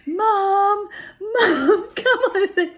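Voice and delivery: high, loud mock call of Mum; a cheeky lad shouting Mum across the room; young man calling. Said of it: "He was like".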